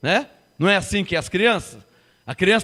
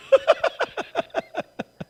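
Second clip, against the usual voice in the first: high breathy laugh; 13 semitones higher, 4 dB quieter than usual. Real, not acted. Laughs after his joke.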